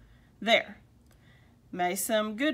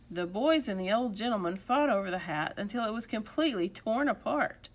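plain reading voice

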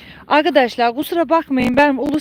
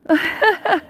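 high, short giggle; delighted laugh; 7 semitones higher than usual. Real, not acted. Owner delighted by her handsome donkey, high giggle.

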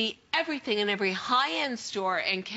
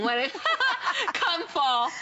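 laughing through the words; 9 semitones higher, 3 dB louder than usual. Real, not acted. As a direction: giggle while saying it. Laughs at her own hiccups.

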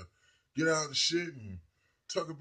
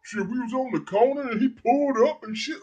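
high, loud mock braggart voice, pitched way up; hammed-up fake gangster bragging about being there; lying wannabe; 12 semitones higher, 14 dB louder than usual. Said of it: "They'll be lying to them".

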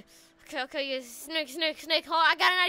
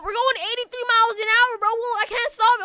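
loud, raised panicked voice; freaking out; 4 semitones higher, 7 dB louder than usual. Pretend. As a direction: call out in alarm from a runaway car. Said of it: "I'm freaking out right now".

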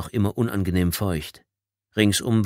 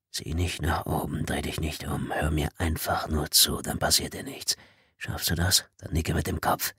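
soft, hushed commands, a little lower; whispered orders; 4 semitones lower, 6 dB quieter than usual. Acted. Stranger in the dark barracks orders Tommy, hushed whisper. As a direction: keep it barely audible and menacing. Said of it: "wisperte die Stimme".

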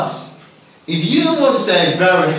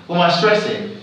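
ordinary delivery

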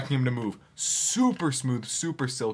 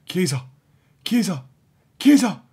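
building from normal to a loud high call; urging on; 5 semitones higher, 9 dB louder than usual. Real, not acted. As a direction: call the name louder each time. Gamer urging player on goal.

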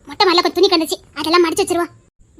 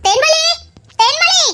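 calling out; 9 semitones higher, slower than usual. Acted. Calling out to Thenmozhi.

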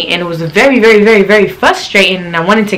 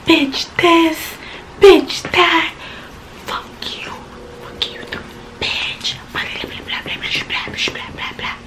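whispered mock cursing; 9 semitones higher, 8 dB quieter than usual. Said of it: "start cursing me out".

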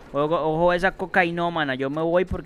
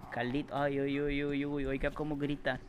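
hushed awe; 3 semitones lower, 12 dB quieter than usual. Real, not acted. Gamer hiding while an enemy screams nearby, hushed. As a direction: drop right down, barely audible.

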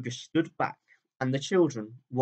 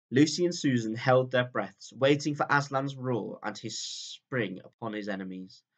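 plain reading voice

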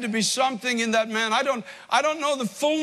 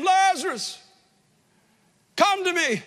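raised, higher call across the tomb; Christ calling out; 6 semitones higher than usual. Acted. Christ calling Lazarus out of the grave, a raised high call. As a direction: call the dead man's name from outside, lifted high. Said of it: "he hears a voice crying out to him".

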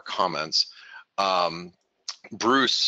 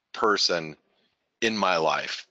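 ordinary delivery

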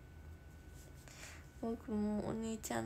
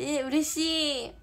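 slow, louder burst of joy; delighted; 4 semitones higher, 9 dB louder, much slower than usual. Real, not acted. Streamer reacting to a viewer's comment, delighted. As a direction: stretch it out, thrilled.

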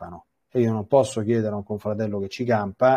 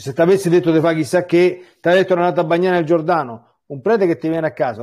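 raised, louder scolding voice, pitch up; rimprovero; 5 semitones higher, 5 dB louder than usual. Retold or imitated. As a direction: scold them like a frustrated prophet. Scolding the reluctant faithful. (rimprovero a voce alta)

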